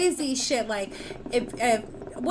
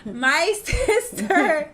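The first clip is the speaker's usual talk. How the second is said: normal talking voice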